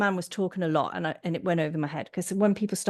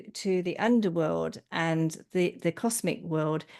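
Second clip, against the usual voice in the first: usual speaking voice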